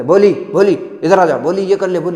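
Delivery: loud, bossy calling out her name; ordering the girl about, loud; harsh calling. Retold or imitated. Family ordering Bholi around, loud and bossy. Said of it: "सब लोग ऐसे कहते थे".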